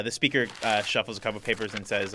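normal talking voice

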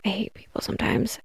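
hushed, half-whispered disgust; weary aside. Real, not acted. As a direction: drop to a near whisper, fed up. Weary aside after grim list.

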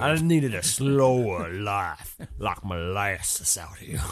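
very slow, syrupy Southern drawl; bayou swamp man. Pretend. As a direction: stretch every word like a swamp local. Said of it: "the guy from the bayou".

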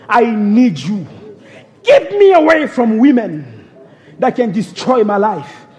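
normal talking voice

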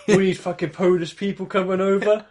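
mock bigoted English expat; mock moaning English expat. Imitating an English retiree in Spain complaining. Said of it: "It's like".

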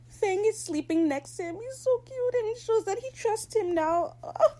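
high, teary gushing. Real, not acted. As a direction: melt over the cute moment. Fan gushing over a sweet scene.

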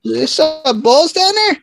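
very high, slow falsetto; squeaky put-on voice. Boy beaten by a girl, mock squeaky voice. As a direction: stretch it out in a comic squeak.

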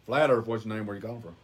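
low, soft radio host voice; deep mock call-in show host. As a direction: answer a caller like a late-night host.

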